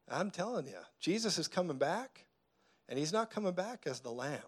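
normal talking voice

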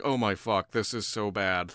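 low, soft, dreading aside; muted dismay. Acted. Yusupov realising the poison has failed, muted dismay. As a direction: mutter it to yourself in mild panic. Acts plotter's private dismay.

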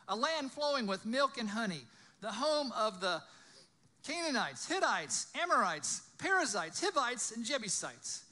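loud, high, punched list of names; dramatic scripture reading. A narrator's reading. Preacher reading out the promised land's peoples, loud and declaimed. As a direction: proclaim each name as its own beat.

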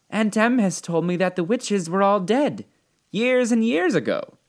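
put-on high-pitched, earnest little girl; young Dorothy. Dorothy tells the witch what her aunt said, earnestly.

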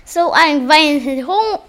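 normal talking voice

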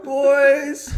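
triumphant cry. Acted. Sales pitch punchline to mates.

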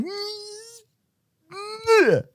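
very high crying nyeh, soft; tongue-out cry. Retold or imitated. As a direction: whimper with tongue out. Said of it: "and I'm like".

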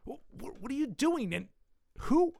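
breathy false starts, then high-pitched questions; flustered stammer. Acted. Father startled by intruders on his table, flustered stammer. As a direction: stammer in shock, pitch jumping up.

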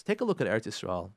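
ordinary delivery

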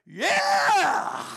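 high screaming rock-concert whoop; wild imitation of an overexcited worshipper; being loud. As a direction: let out a long, piercing yell. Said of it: "you know, being loud".

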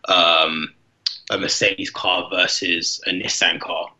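ordinary talk, nothing marked

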